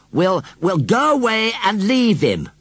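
high, loud, drawn-out announcement; said loudly. A narrator's reading. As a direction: call it out so an eavesdropper hears.

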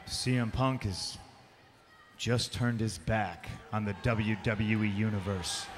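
quoting Lawler back at him, lightly mocking; mock quote of Jerry Lawler. To Jerry Lawler, reading his words back in a lightly mocking voice. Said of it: "and I quote".

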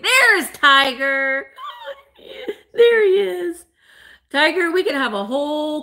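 laughing. Real, not acted. Host laughs at her cat Tiger walking into view, laughing.

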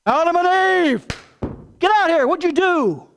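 loud, high angry call; angry shouting God. Pretend. God yelling at Adam and Eve to come out, loud and angry. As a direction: bellow at them like a furious father. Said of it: "What kind of voice do you hear?".

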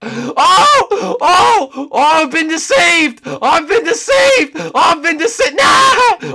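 hammed-up high screaming wail, drawn out; melodramatic wailing. Player crying out to the game that he's been deceived, melodramatic wailing. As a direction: shriek in mock betrayal.

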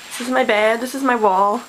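ordinary delivery

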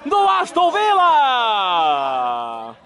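stretched-out roaring goal call; explosive goal commentary. Real, not acted. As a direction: belt out the goal, holding the vowels. Goal scored, commentator erupts.

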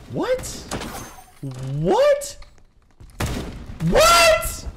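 stunned falsetto what; rising disbelief. Real, not acted. Repeated disbelief, pitch climbing.

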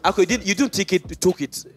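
normal talking voice

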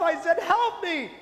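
high, loud cry, laughing through it; mock-drowning shout, half laughing; retold yell for help. Retelling how he yelled to the priest, hammed-up panic. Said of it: "And I yelled".

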